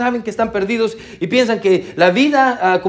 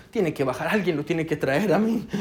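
softer, slowed mock announcement; lightly imitated lobby worker, much softer; hushed lobby talk; 5 semitones lower, 9 dB quieter than usual. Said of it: "estaba como".

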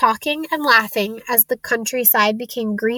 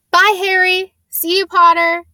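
higher, louder farewell calls; calling out; 8 semitones higher, 5 dB louder than usual. A narrator's reading. Schoolmates to Harry at the station, calling out. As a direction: call goodbye across a crowd. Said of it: "Some of them called".

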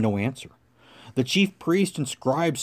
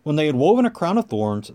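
ordinary delivery

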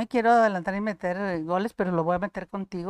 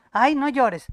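mock admonishment; 4 semitones higher, 6 dB louder, slower than usual. Voices people hushing a crier.

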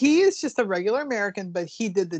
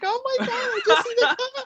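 laughing; 4 dB louder than usual. Retold or imitated. Retelling her own reaction, laughing.

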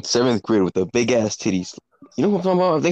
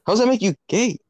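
high disbelieving question; incredulous high; 11 semitones higher than usual. Real, not acted. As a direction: ask it like you can't believe it.

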